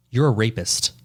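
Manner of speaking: usual speaking voice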